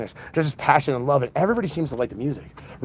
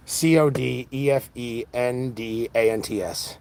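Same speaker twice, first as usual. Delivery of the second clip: slow letter-by-letter spelling; spelled out; slower than usual. Real, not acted. Host spells band name for viewers, slow spelling.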